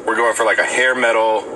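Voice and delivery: usual speaking voice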